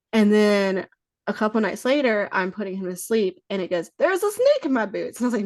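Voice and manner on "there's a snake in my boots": high, chirpy pull-string toy voice; talking toy voice. Retold or imitated. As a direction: mimic the Woody doll's recorded line, pitched way up.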